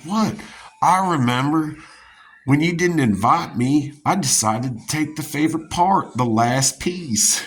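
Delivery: high, loud imitation of an indignant mom, last words slowed; mom's angry reply. Mom tells her son she took the last piece, high indignant imitation. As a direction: mimic mom's huffy accusation. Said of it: "she's like".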